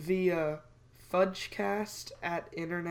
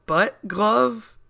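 incredulous; 4 semitones higher, 11 dB louder than usual. Real, not acted. Incredulous repeat.

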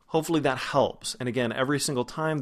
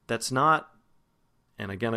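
ordinary talk, nothing marked

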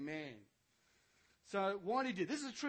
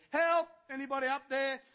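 high, loud yell of help; man hanging from a branch yelling for help; cry for help; 8 semitones higher, 13 dB louder than usual. Acted. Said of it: "he's crying out".

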